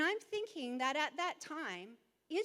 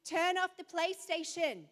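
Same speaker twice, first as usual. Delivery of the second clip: firm, higher, commanding mum; stern mum orders; 4 semitones higher than usual. Retold or imitated. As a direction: lay down the law to the kids. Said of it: "in that room going".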